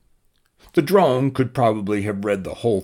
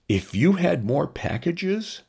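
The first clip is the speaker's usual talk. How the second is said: higher, curious robot voice; high drone voice; 11 semitones higher than usual. Acted. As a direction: wonder aloud like a small machine.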